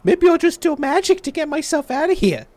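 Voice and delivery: high, loud defiant taunt. Acted. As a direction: goad the guard defiantly. Inmate taunting guard.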